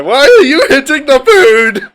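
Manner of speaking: high loud exasperated shout. Acted. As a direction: yell over the hammering. Character yells at hammering.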